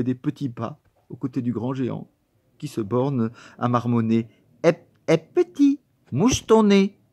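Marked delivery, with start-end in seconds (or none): 4.6-7.0 high, slow talking-down voice; patronizing teacher. Acted. Teacher telling the boy to blow his nose, high and slow. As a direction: speak to him as to a toddler.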